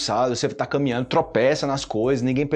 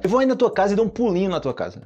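higher and quicker explaining; quoted friend; 7 semitones higher, faster than usual. Retold or imitated. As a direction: rush through it casually. Explaining the expression in character. (imitando, mais agudo e rápido)

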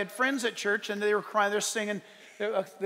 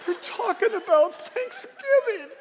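high, loud, slow fake sobbing; mock weeping announcer; 7 dB louder, slower than usual. Preacher imitating someone reading church announcements, mock weeping. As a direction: bawl through a dull notice, hammed up. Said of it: "he's like".

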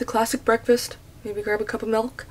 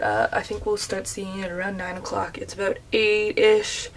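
usual speaking voice

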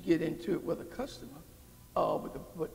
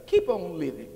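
louder, pressing the words keep on living; firm exhortation; 6 dB louder than usual. Acted. Preacher urging the congregation to keep going, firm push. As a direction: urge them on, keep on living, firmly. Repeated exhortation, loud take.

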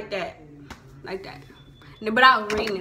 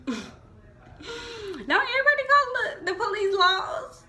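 higher, slow question through laughter; laughing question; 11 semitones higher, slower than usual. Real, not acted. Vlogger laughs while asking viewers about slang, giggling high.

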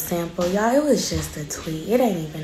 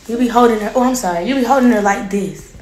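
scolding; 3 semitones higher, 9 dB louder, faster than usual. Real, not acted. Mother scolds about holding baby.

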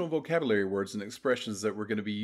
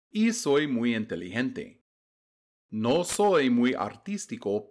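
a little faster. Shown as a demonstration. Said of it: "read that again a little faster".

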